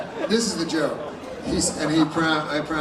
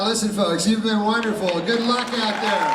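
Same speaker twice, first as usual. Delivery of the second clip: usual speaking voice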